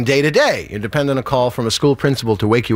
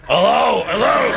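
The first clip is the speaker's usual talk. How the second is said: higher each time, trying out the pitch; testing your voice; 6 semitones higher, slower than usual. Retold or imitated. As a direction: warm up the sleepy voice. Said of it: "Do you ever test your voice".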